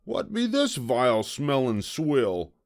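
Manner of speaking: pirate recoiling from a bad smell; disgusted pirate. A narrator's reading. Disgusted pirate captain asks.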